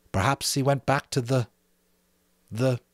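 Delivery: ordinary delivery, nothing marked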